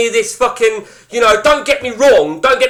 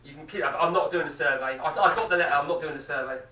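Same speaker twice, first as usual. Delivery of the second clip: low, quiet, distant off-mic reply; away at the door; 7 semitones lower, 14 dB quieter, faster than usual. Real, not acted. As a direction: turn away and answer a doorstep caller. Refuses caller at door, off mic.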